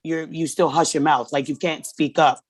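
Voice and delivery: normal talking voice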